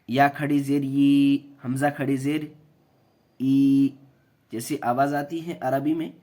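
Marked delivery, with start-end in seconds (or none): 0.1-3.9 یا کھڑی زیر ی spelled slowly and firmly; slow spelling out. Shown as a demonstration. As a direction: spell out the letter names slowly. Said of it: "یہ کی آواز جیسے".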